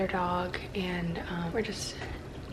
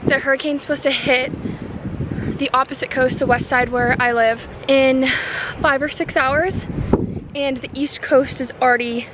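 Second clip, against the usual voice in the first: talking loudly over strong wind; windy clifftop; 3 semitones higher, 5 dB louder than usual. Real, not acted. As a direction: raise your voice over gusting wind. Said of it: "Sorry if you can't hear me over the wind".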